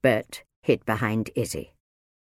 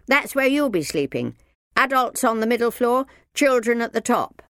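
put-on high, louder boy's voice; helpful child; 12 semitones higher, 5 dB louder than usual. A narrator's reading. Sam tells the minder where she sleeps, matter-of-fact. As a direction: explain the floors in a high voice.

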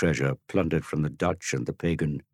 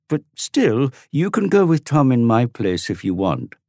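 put-on high, louder boy's voice; pleading boy; 8 semitones higher, 6 dB louder than usual. Dorian to Tom, high loud pleading. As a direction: argue your case. Boys arguing.

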